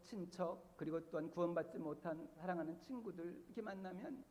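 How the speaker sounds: ordinary delivery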